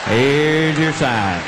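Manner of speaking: slow deadpan with weight on your; slow catchphrase. Acted. Comic to the audience, slow deadpan punchline. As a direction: draw it out flat.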